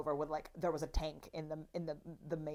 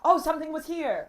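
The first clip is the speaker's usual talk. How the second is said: mock surprise; 10 semitones higher, 10 dB louder than usual. Mimicking child's surprise.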